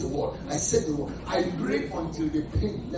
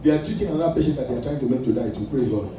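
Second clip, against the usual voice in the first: usual speaking voice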